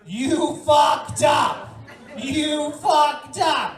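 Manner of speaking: loud, slow chanted yell; crowd yell. Retold or imitated. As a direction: yell it at the guy who said bingo. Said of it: "we all yell".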